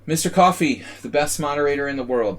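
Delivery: loud, high hype introduction; hype announcer. Acted. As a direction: announce him like a champion. Hyping up the moderator.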